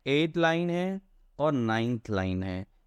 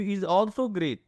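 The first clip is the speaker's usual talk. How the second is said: poem reading; 3 semitones higher, much slower than usual. Shown as a demonstration. Teacher reads poem line slowly.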